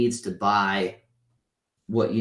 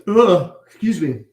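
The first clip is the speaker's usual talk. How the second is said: yawning apology; 9 semitones higher, 10 dB louder than usual. Real, not acted. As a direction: end of a real yawn, much higher and louder. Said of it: "Shouldn't be yawning".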